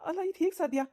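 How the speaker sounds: high, incredulous questioning; astonished disbelief. Acted. Husband asks neighbour how this is possible, astonished. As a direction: question it in happy shock.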